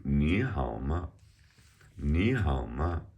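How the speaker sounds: slow "你好吗", light neutral 吗 at the end; neutral-tone demo. Shown as a demonstration. Teacher modelling line two, slow "你好吗" with neutral 吗. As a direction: draw out 你 and 好, leave 吗 toneless. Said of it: "is a neutral tone here".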